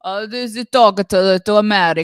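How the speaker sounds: loud, badly faked Italian accent; horrible fake Italian accent. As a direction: do a hammy Italian tenor accent. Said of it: "putting on a horrible fake Italian accent".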